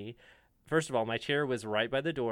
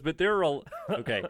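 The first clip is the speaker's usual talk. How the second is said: ordinary talk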